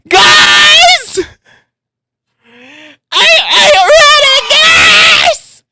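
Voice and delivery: very high, loud shriek; excited screaming. Real, not acted. Streamer at peak hype before reveal, screaming. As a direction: scream every word at full pitch.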